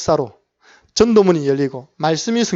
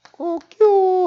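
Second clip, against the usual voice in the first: high crowing cock-a-doodle-doo; rooster crow; 14 semitones higher, much slower than usual. Retold or imitated. Rooster crowing after Peter's third denial, high mock crow. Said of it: "하고 닭이 울었답니다".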